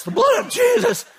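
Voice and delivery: very high, loud shouted plea; shouted rebuke. Retold or imitated. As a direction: yell it out desperately. Preacher battling demon, shouting.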